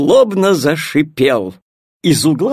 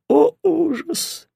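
plain reading voice

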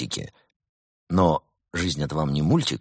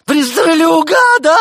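bad cop shriek; 11 dB louder, slower than usual. A narrator's reading.

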